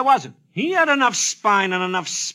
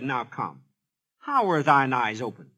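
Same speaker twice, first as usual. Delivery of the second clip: sly and subtle; 6 semitones lower than usual. Retold or imitated. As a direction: coax the answer out slyly, under his breath. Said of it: "And slyly and subtly the spokesman said".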